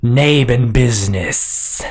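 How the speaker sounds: slow, drawn-out snake creature challenge; slow serpent sentry voice; snake-headed guard. Acted. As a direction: demand it slowly as a monster guard. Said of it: "one of the snake heads swivels towards you and says".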